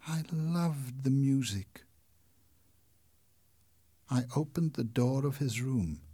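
ordinary delivery, nothing marked

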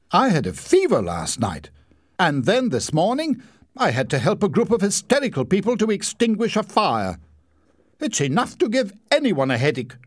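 high, aggrieved lecture; self-pitying pomp. A narrator's reading. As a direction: play the martyr. Larry justifying himself.

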